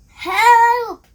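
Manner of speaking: high loud scream for help. A narrator's reading. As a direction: scream it in panic. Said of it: "they screamed".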